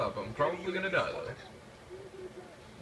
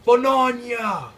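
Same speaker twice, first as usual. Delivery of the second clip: long high shout, much louder; wild yell; 9 semitones higher, 11 dB louder, much slower than usual. Pretend. Gamer yelling a nonsense battle cry, high and loud. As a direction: belt it out like a crazed battle cry. Exclaims mid-game.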